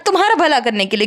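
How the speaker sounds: usual speaking voice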